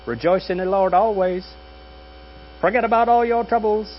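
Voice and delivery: high, sing-song greeting-card verse; mock Hallmark sweetness. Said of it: "Doesn't it sound like a Hallmark card?".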